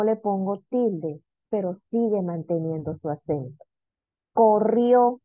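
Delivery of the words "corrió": Corrió, loud, weight on the final RIÓ; teacher's loud demo of Corrió stressed at the end; stress on last syllable. Shown as a demonstration. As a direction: lean hard on RIÓ in Corrió. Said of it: "Última sílaba".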